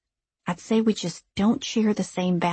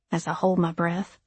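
plain reading voice

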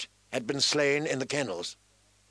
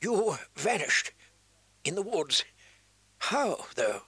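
weak, dying voice; 5 semitones higher than usual. A narrator's reading. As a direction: ask it with failing breath. Dying maester questions Bran.

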